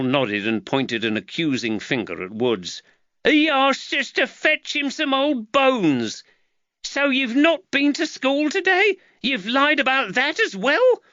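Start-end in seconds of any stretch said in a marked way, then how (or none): 3.2-6.2 put-on high, slow boy's voice; boy accuses the constable; accusing boy. Nathaniel accusing. 6.8-11.0 high, louder horrified woman; mother horrified at her son; scolding mother. Acted. Giacchetta scolding.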